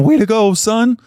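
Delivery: high, loud cheer; cheering from the stands. Retold or imitated. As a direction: cheer your boy on from the bleachers. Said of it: "cheering in the stands".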